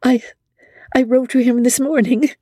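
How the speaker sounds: a blushing confession; Amelia shy. A narrator's reading. Amelia admitting she already wrote, bashful stammer. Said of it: "Emmy said, blushing".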